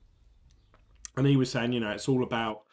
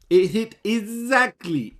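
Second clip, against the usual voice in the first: "exactly" stretched slowly, much higher and louder; excited stress on exactly; 9 semitones higher, 8 dB louder than usual. Real, not acted. Vlogger gloating to viewers, stressing "exactly" slowly and loud. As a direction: savour the word "exactly" as the call lands. Gloating over a correct prediction.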